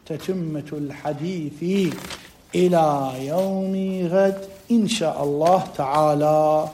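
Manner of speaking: slow, low, softened devotional cadence; formal closing blessing. Real, not acted. Teacher closing the lesson with salawat, slow low formal blessing. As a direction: draw it out slowly and quietly, winding down.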